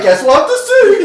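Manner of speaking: high, loud taunt breaking into a laugh. Retold or imitated. Taunting the man with the golf club, a laughing retort. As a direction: toss it back, laughing.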